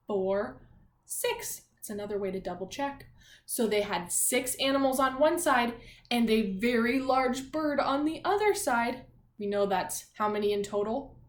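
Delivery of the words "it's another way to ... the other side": ordinary talk, nothing marked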